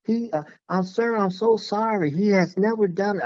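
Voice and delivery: stunned owner apologizing to the stranger, high mock voice; imitating a flustered lady. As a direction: squeak it out like a stunned dog owner. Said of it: "she was like".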